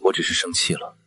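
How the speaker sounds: soft, hushed admission; subdued apology. Acted. Man apologising to the woman for his jealousy, subdued. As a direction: back down very quietly.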